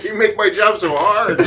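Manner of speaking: high, real laughing exasperation; laughing complaint. Real, not acted. Game master to unruly players, complaining through laughter. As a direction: complain while cracking up.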